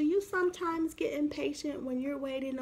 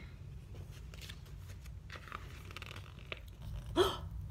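soft gasp; 10 dB quieter than usual. Acted. Suspense before mama appears.

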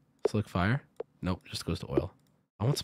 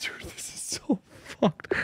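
frustrated whisper; 6 semitones higher, 7 dB quieter, slower than usual. Real, not acted. Frustrated at the game, muttering.

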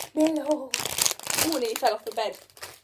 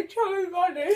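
laughing; 4 semitones higher, 9 dB louder than usual. Real, not acted. Laughing with mouth full.

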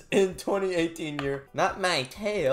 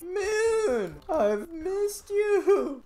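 mock tearful longing; 12 semitones higher, much slower than usual. Reunion with Moon character.

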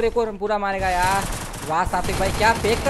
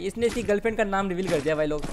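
ordinary delivery

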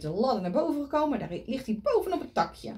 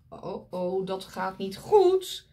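ordinary delivery

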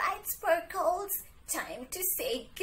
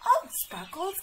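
ordinary talk, nothing marked

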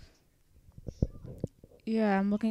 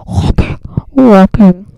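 mock yell; 21 dB louder, slower than usual. Chant builds to a yell.

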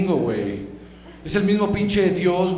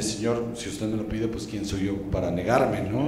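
much lower, softer, resigned servant voice; meek Abraham; 10 semitones lower, 8 dB quieter than usual. Acted. Abraham meekly agrees to God's demand, low and soft. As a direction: mumble it like a submissive servant. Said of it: "Y Abraham así de".

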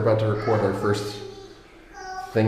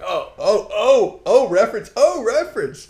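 excited; 12 semitones higher than usual. Retold or imitated. Excited recognizing a reference.

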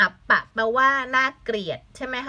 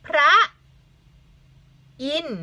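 "พระ... อินทร์" with a long pause between; syllable by syllable; 3 semitones higher, 3 dB louder than usual. Shown as a demonstration. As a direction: sound out "พระ" and "อินทร์" separately, teacher-style. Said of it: "คำนี้". (อ่านแยกคำ "พระ - อินทร์" ช้าๆ)

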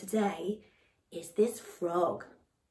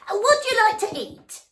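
loud frog; 13 semitones higher, 11 dB louder than usual. Acted. Noisy frog loudly introducing himself to the elephant. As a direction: proudly bellow the name.

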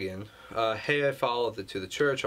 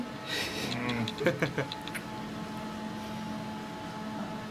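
a long giggling laugh; high giggle; 11 semitones higher, 6 dB quieter than usual. Real, not acted. Player watching the cat clip, high laughing. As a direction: laugh it off in delight.

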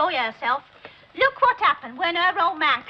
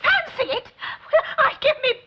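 eager; 10 semitones higher than usual. Acted. Eager reply to holiday idea.

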